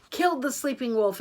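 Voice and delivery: ordinary delivery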